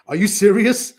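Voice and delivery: laughing, high and loud, at a silly question; mocking chuckle, disbelieving; retold incredulous laugh. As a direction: scoff through a laugh. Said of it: "He said, he said".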